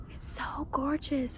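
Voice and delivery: hushed breathy whisper in awe. Real, not acted. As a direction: whisper it softly, in wonder. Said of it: "I feel like I have to whisper".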